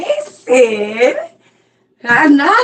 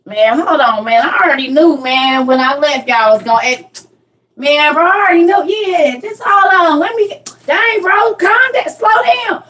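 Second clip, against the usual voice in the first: higher, rushed flustered homeboy; excited friend; 6 semitones higher than usual. Retold or imitated. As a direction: act out a friend scrambling for the answer. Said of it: "this the homeboys right here".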